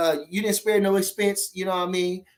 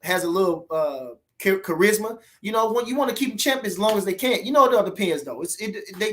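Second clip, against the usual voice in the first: ordinary talk, nothing marked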